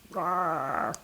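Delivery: a soft, high little rawr; a caterpillar trying to roar; tiny growl. Acted. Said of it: "Hugo growled at her".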